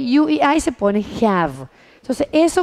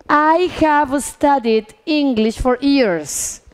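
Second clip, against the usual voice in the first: slow, deliberate model sentence; drawn-out classroom example; slow example reading; much slower than usual. Shown as a demonstration. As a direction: read it slowly for English learners. Teacher models English example sentence.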